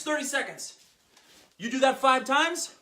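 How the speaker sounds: ordinary talk, nothing marked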